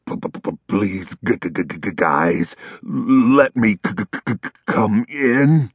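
teeth-chattering cold stammer; begging while shaking with cold; shivering stutter. A narrator's reading. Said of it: "he begged".

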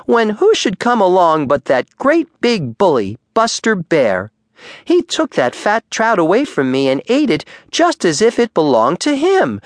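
in a rage. A narrator's reading. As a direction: spit out the grievance. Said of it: "in a terrible rage".

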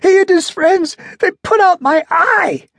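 high, loud near-tearful voice; on the verge of tears; almost crying. A narrator's reading. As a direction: ventriloquist confessing, high and close to tears. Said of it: "almost crying".

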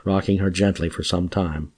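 usual speaking voice

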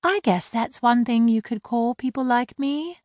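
high-voiced mermaid mocking his slur; wry mermaid. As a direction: let the sarcasm sit lightly. Laurel wry.

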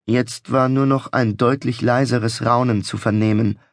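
ordinary delivery, nothing marked

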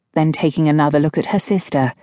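usual speaking voice

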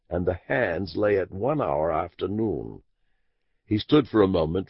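ordinary delivery, nothing marked

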